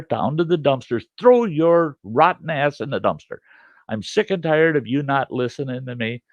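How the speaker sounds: retold angry rant at a disobedient camera; angry tirade. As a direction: chew the camera out like a bad dog. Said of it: "screaming and hollering".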